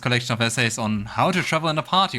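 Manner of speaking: loud, biting reply read as the other companion; sarcastic comeback. Acted. Brotherland firing back at his teasing companion, loud and sarcastic. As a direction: snap back with heavy sarcasm.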